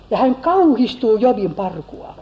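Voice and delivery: ordinary talk, nothing marked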